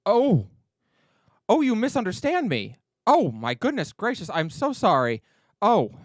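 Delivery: high, loud flustered apology; embarrassed fluster. Acted. Jorah apologizing to the table for the misunderstanding, flustered and high. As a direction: scramble to take it back, mortified. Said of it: "he gets a look on his face of embarrassment".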